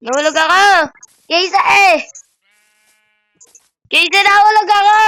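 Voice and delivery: loud high panicked yelling; screaming while falling. Real, not acted. (sumisigaw habang nahuhulog)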